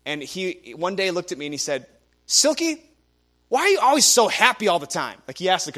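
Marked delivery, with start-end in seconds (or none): 2.3-5.2 retold, loud, high and irritated; a coworker snapping in mock annoyance; mad tone. Coworker confronting him about being happy, loud mock annoyance. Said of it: "in a really mad tone".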